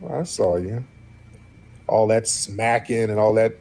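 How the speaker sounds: ordinary talk, nothing marked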